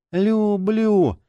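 high, strained gasping word; forced out, dying. A narrator's reading. Wounded wife to her husband, strained dying effort. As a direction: squeeze the word out while dying. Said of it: "выдавил из себя Джина".

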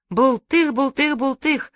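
high, bouncing string of splash words; imitated splashes. A narrator's reading. Several people jumping in, high playful splash words.

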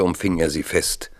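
ordinary talk, nothing marked

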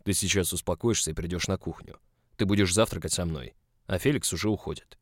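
controlled firm instruction. A narrator's reading. Boss laying down breakfast orders, voice nearly normal. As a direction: bring the growl down to firm orders. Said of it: "уже понизив голос до почти обычного".

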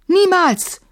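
high, loud defiant scream; shout. A narrator's reading. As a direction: yell it up at the monster. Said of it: "schrie sie hinauf".

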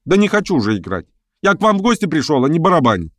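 high-pitched, protesting outburst; exasperated refusal. A narrator's reading. Pianist refusing the hostess's request, exasperated.

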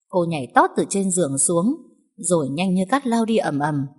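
ordinary delivery

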